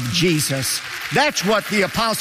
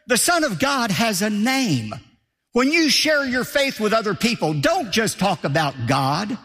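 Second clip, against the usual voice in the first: ordinary talk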